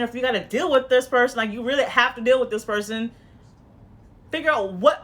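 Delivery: ordinary talk, nothing marked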